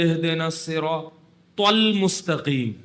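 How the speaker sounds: slow, ت in المستقیم hit hard; overdone ta sound. Retold or imitated. Imam who only learned ط, slow, pronouncing المستقیم with heavy ت. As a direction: exaggerate the ت in المستقیم. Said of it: "جہاں تو آئے گی نا".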